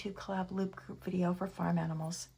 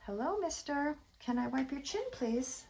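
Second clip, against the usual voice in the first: higher-pitched sweet talk to a pet; 7 semitones higher, 3 dB louder than usual. Real, not acted. Owner asking pet to let her wipe his chin, pet talk. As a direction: coax a little one in a raised voice.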